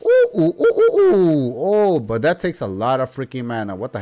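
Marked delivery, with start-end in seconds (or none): 0.0-1.5 string of high, loud oohs; high-pitched excited ooh-ooh; excited high hoots. Real, not acted. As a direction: hoot rapidly in falsetto delight. Delighted at new weapon move.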